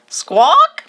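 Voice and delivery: high bright squawk like a macaw; parrot squawk. A narrator's reading. The kite parrot greeting the boys with a squawk. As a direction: screech it like a parrot.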